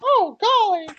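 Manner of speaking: very high, loud cartoon-mouse falsetto; Mickey Mouse. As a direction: exclaim it as a squeaky cartoon mouse. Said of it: "the pre-show Mickey Mouse voice".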